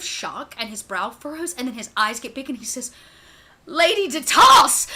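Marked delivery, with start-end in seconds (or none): 3.7-4.9 loud, slow, high cry of recognition; joyful cry. Acted. Butler shouting as the lost lady returns, overjoyed. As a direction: call her name as if she's back from the dead.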